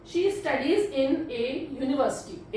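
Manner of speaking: very slow, word by word; slow dictation. Shown as a demonstration. Teacher reading the answer slowly to students. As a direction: spell out the whole sentence slowly. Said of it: "एक बार देख लेते हैं सारे sentence अच्छे से".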